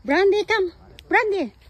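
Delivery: loud, high-pitched dog call. Real, not acted. Calling her dog to come.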